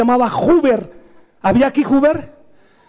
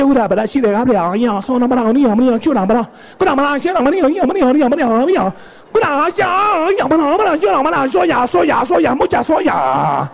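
sing-song mock-Japanese babble; self-sacrificing ninja wife. Devoted wife answers her ninja, sing-song mock Japanese. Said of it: "la esposa, toda abnegada, le dice".

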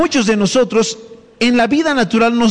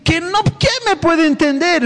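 higher, pleading voice; mock despairing believer; 7 semitones higher than usual. Pastor voicing a struggling believer, pleading. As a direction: plead like someone who feels unseen. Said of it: "Alguien dirá".

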